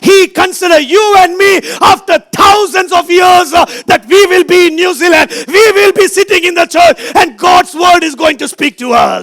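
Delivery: high, sustained pulpit proclamation; fervent preaching. Acted. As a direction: preach it out to the whole congregation. Preaches at raised force.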